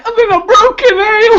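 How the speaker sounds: high, loud comic northern character; Peter Kay impression. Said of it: "Do your impression".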